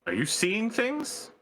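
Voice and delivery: slow, high, a hint of Dutch; drifting Dutch accent. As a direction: ask it slowly as a wry foreign soldier. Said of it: "becoming more Dutch".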